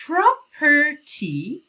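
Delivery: "property" said very slowly with a short schwa; careful model of "property". Shown as a demonstration. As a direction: stretch out "property" slowly, schwa after the second p. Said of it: "یه صدای خیلی کوتاه به اسم شوا".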